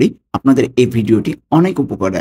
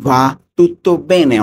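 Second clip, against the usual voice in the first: "Va tutto bene" spoken very slowly, pitched higher; 4 semitones higher, much slower than usual. Shown as a demonstration. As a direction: draw out "Va tutto bene" so learners hear each word. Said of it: "পুরাটা কি দাঁড়াবে".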